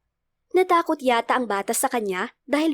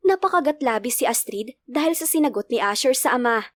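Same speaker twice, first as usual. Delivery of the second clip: usual speaking voice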